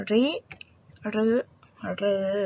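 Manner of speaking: ริ, รึ, รือ said one by one as separate sounds; teacher models ริ then รึ then รือ; three ways to read ฤ. Shown as a demonstration. Teacher shows class three readings of ฤ, spaced out. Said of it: "สระ รึ เราออกเสียงได้สามแบบนะคะ".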